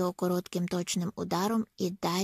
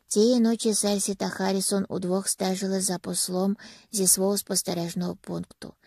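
ordinary delivery

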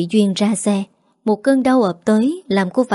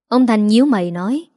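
ordinary delivery

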